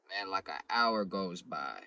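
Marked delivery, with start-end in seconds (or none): none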